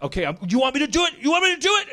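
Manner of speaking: high, fast, loud hysterical challenge; frantic outburst. Acted. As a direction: spit it out, rising to a shriek. Character snaps at ghost voice.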